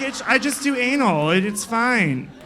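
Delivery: brushing it off, half-laughing; mock defensive. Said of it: "He's like".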